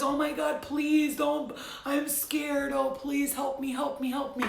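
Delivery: high, loud, hammed-up frightened begging; mocking the scared sleeper, high and loud; mock scared pleading. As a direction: play the helpless victim cowering in bed. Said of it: "Don't just lay there in your covers".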